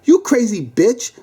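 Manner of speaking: angry cop, pitched high; high, angry outburst; yelling. Acted. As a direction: yell the insult in exasperation. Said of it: "the cop yelled".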